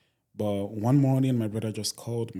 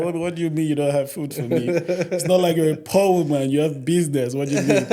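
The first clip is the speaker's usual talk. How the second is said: higher, louder, fast disbelief; can't believe his mother said that; laughing outrage at mom; incredulous protest; 6 semitones higher, 7 dB louder than usual. Retold or imitated. His indignant reply to mom.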